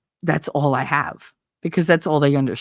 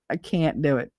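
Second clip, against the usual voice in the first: ordinary talk, nothing marked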